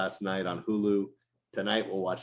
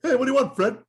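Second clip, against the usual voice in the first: high, loud cartoon retort; Flintstones cartoon wife; 7 dB louder, faster than usual. Cartoon wife answering Fred, high and loud. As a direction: snap back like a cartoon housewife.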